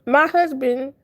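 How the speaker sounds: ordinary talk, nothing marked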